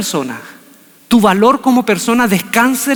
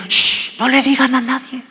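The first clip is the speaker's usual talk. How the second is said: hushing the crowd; 5 semitones higher than usual. Retold or imitated. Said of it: "le decía".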